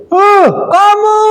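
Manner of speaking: very high, slow, pointed "Heh, kamu."; high snapping teacher. Shown as a demonstration. Teacher demonstrating the punisher's high scolding voice to the class. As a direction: bark it at the student from way up high. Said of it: "Nadanya suara tinggi".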